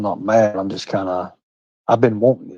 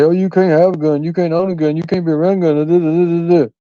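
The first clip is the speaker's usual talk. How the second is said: higher mocking whiny voice parroting her; 6 semitones higher than usual. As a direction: mimic her nagging, sing-song and dismissive. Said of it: "And for her to say".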